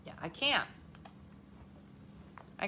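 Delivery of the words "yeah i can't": ordinary talk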